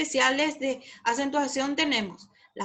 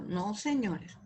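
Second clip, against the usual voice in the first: ordinary talk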